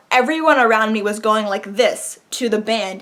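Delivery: normal talking voice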